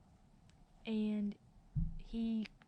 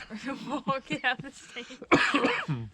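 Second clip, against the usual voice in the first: laughing; 4 semitones higher, 9 dB louder, much slower than usual. Real, not acted. Laughing while telling the story.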